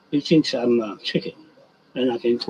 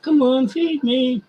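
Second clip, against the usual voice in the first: higher and slow, a light chicken voice; voicing the chicken; 11 semitones higher, 4 dB louder, slower than usual. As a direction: lightly speak for the begging chicken. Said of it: "He's saying".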